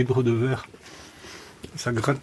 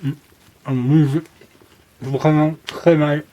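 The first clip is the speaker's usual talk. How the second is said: mouth full; 3 semitones higher, 4 dB louder than usual. Real, not acted. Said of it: "je vais parler la bouche pleine".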